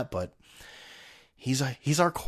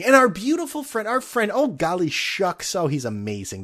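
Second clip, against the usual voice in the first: mock gushing; 6 semitones higher than usual. Mock praise of Eli Manning.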